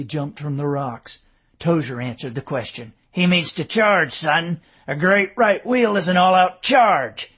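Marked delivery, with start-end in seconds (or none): 3.1-7.3 put-on louder, gruff old soldier; gruff explanation. A narrator's reading. Old sergeant explains to the young lieutenant, gruff and loud. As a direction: explain it bluntly to the boy.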